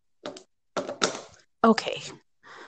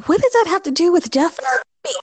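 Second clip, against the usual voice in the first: high, fast protest; 10 semitones higher, faster than usual. Acted. Caller protests, confused.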